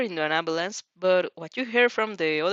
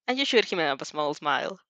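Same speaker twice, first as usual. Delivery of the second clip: usual speaking voice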